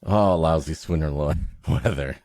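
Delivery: ordinary talk, nothing marked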